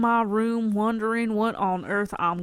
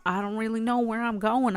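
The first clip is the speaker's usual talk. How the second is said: usual speaking voice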